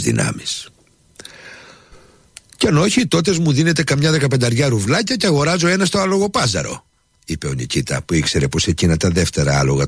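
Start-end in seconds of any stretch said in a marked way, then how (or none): 2.6-6.9 much higher, cautious bargaining servant; a peasant politely countering the offer; shrewd servant. A narrator's reading. Nikita bargains. (ψηλή, προσεκτική φωνή υπηρέτη που παζαρεύει)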